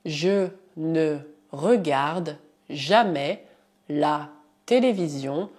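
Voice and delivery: "je ne regarde jamais" said slowly in chunks; teacher dictating slowly; slow dictation. Shown as a demonstration. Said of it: "Por exemplo".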